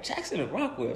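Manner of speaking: quiet repeat under his breath; mumbled street names; muttering to himself. Retold or imitated. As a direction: say it softly, half to yourself. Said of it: "I just kept saying to myself".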